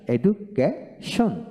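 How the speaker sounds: Education slowly split as এডু, কে, শন; very slow, broken-up education; syllable by syllable. Shown as a demonstration. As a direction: pause between the parts of education. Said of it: "অংশ একসাথে উচ্চারণ করা যায়".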